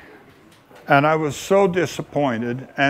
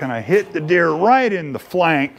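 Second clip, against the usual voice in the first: ordinary talk, nothing marked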